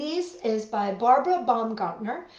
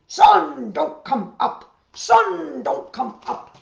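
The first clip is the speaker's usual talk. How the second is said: loud shouted bear command; bear shouting; 6 semitones higher, 10 dB louder, slower than usual. A narrator's reading. Bear shouting at the rising sun to stay down. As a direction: yell it at the sky like a desperate bear. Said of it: "started shouting".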